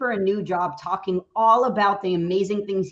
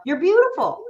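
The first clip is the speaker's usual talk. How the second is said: shout it; 9 semitones higher, 5 dB louder than usual. Shown as a demonstration. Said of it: "why not just shout".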